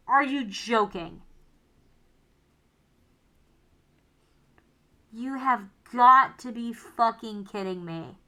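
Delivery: loud exasperated rant; angry gamer shouting at bad luck; frustrated yelling. Real, not acted. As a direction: vent your real frustration loudly. Real frustrated rant at the game.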